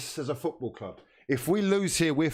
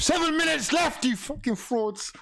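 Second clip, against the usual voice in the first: furious shout; 12 semitones higher than usual. Real, not acted. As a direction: rage at the players. Repeated louder at the team.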